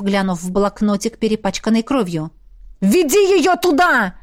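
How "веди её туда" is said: high, drawn-out urgent shout. A narrator's reading. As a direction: shout the order across. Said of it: "выкрикнул Эммет". (настойчивый выкрик)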